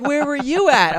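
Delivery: high, clueless put-on voice; mock buddies' question. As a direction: play the oblivious squadmates. Quoting his buddies.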